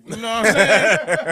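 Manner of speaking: laughing. Real, not acted. Laughing while making his point.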